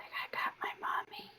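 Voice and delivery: soft whisper as the cat; whispered cat thoughts. Pretend. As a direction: breathe the cat's happy thoughts. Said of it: "She's like".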